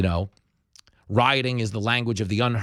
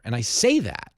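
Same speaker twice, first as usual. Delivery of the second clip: normal talking voice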